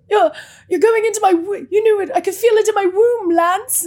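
high, loud overacted movie line; hammed-up fake line reading. Friend retelling a terrible movie line, overacted and high. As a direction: ham it up like a bad translated film actress. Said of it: "she had this, like, fake translated line".

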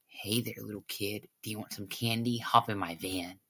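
put-on low, hushed creep luring a child; soft, low and sinister coaxing; creepy kidnapper. Creepy man luring a kid into a van, low and hushed. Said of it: "like the creepy kidnapper dude".

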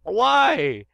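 high, loud startled professor; a flustered scientist knocked over, squeaking; Professor Sycamore voice. Acted. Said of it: "Hi, I'm Professor Sycamore".

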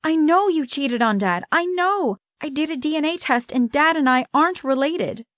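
high-pitched, heated outburst; shouted accusation. A narrator's reading. Child confronting mother with DNA proof, shouted accusation. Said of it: "I suddenly shouted".

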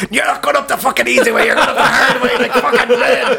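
high, hammed-up angry order; mock yelling. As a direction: play the bullying guide through laughter. Mock row over the route.

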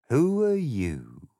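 slow, drawn-out drowsy caterpillar; languid, sleepy. Acted. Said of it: "in a languid, sleepy voice".

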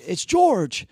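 high-pitched imitated caller; George Greenough. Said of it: "And he goes".

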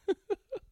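short high-pitched laugh; squeaky laugh. Real, not acted. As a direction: burst out laughing. Laughing at own situation.

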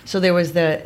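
normal talking voice

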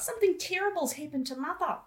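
high, laughing Australian line; mock Australian accent with a laugh; hammed-up Australian accent. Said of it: "doing an Australian accent".

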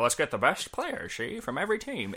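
a touch of a brash 1920s banker; 1920s Wall Street bull. Retold or imitated. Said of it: "in your nineteen twenties bull voice".

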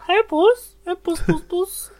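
high, coaxing kitty call; high cooing call to a cat. Retold or imitated. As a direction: coax the cat out sweetly. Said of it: "I'm like".

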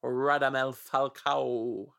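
Radamel Falcao said very slowly, vowels stretched; relishing Radamel Falcao, drawn out; slow chewy vowels. Shown as a demonstration. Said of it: "Chewy vowels".

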